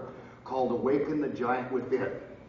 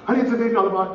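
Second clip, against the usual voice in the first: Honey, it's a million dollar bond said higher and louder; excited, shaking; 6 semitones higher, 6 dB louder, much faster than usual. Retold or imitated. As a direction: blurt it out, hands shaking with excitement. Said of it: "She starts shaking her hands".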